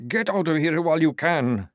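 high, strained warning; strained mumble. A narrator's reading. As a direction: warn them while losing control. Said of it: "he mumbled as his teeth visibly lengthened".

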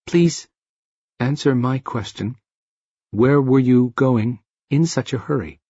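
even, inflectionless delivery; a hint of robotic speech. A narrator's reading. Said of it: "He spoke robotically".

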